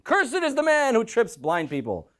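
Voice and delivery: high, drawn-out public proclamation; crowd proclaiming a curse. Acted. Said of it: "the people on this mountain say".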